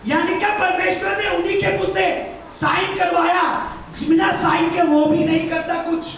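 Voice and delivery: high, loud preaching cry; preaching at full force. Acted. Pastor urges the congregation, preaching loud. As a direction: preach it out over the hall.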